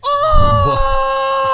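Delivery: high hyped yell; excited shout. Real, not acted. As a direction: whoop it up loud. Hyped reaction to clip.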